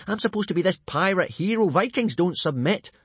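put-on high-pitched boyish protest; insist, affronted, on your dignity; indignant. A narrator's reading. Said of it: "said Hiccup indignantly".

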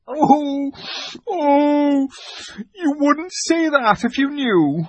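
high sobbing oh before the words; between sobs. Acted. The fawn answers Lucy between sobs, sobbing. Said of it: "between his sobs".

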